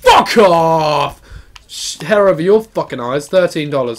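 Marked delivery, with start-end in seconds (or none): 0.0-1.2 annoyed shout. Real, not acted. Shouting at the game.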